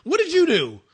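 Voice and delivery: high-pitched, incredulous questioning; incredulous challenge. Acted. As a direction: challenge them in mock disbelief. Acted challenge to gloating winner.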